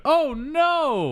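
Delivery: high squeaky alarmed yelp; mock panic. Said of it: "He's like".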